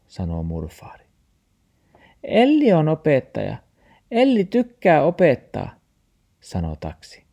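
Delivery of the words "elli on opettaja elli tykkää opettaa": high, loud protesting voice; taxi character. A narrator's reading. Taxi says Elli should teach Max, high and loud. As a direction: pass the job on in a high-pitched voice.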